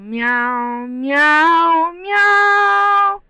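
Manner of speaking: imitating the pretend kitty's meows; building cat meows. Adult mimicking the kitty.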